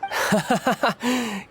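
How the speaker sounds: short, high, easy chuckle; light amused laugh, unbothered. Acted. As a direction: laugh off the accusation. Amused by her jab.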